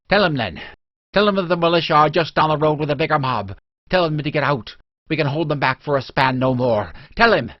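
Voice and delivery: loud, high, fast pleading warning; urgent plea. A narrator's reading. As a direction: push him to go, the mob is coming. Urgent warning, mob coming.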